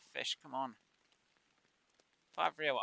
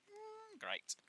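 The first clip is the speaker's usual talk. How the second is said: sarcastic; 5 dB quieter than usual. Retold or imitated. Imagined game kicks him out.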